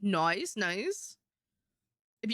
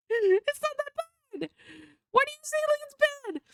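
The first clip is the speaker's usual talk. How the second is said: squeaky mock voice. Teasing a friend in a squeaky voice. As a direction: squeak like a cartoon.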